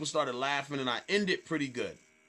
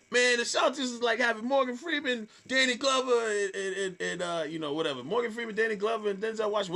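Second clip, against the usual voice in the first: higher, lightly performed stage delivery; retelling the joke on stage; 8 semitones higher than usual. Retold or imitated. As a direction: pitch the joke like a nervous comic on stage. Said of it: "I start going into the joke".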